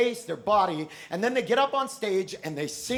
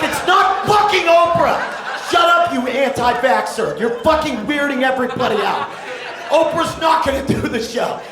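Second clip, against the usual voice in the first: louder, higher fed-up yelling; exasperated rant; 4 semitones higher, 7 dB louder than usual. Acted. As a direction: tell her off at the screen. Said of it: "And you're like".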